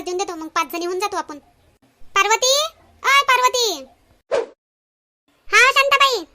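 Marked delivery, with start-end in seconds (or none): 2.2-3.8 loud, higher call across the way. Acted. Calling out to a friend. 5.5-6.2 loud, higher reply from a distance; distant friend calling back; answering call. Acted.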